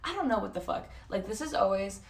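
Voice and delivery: ordinary talk, nothing marked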